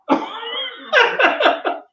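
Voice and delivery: sudden high burst of laughter; short, high cackle of a laugh. Real, not acted. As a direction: laugh it off at his own comeback. Laugh after his retort.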